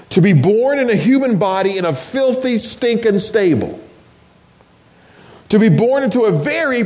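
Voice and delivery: impassioned preaching, rising and falling. Acted. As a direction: preach it with full conviction. Preacher building up to climax.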